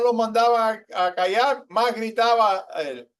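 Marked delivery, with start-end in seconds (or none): none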